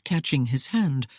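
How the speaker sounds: ordinary delivery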